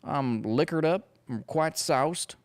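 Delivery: high, loud Southern politician drawl; tipsy Southern senator impression. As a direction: play a liquored-up Southern gentleman. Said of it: "perfect my Southern drawl".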